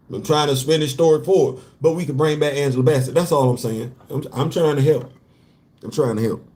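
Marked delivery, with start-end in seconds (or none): none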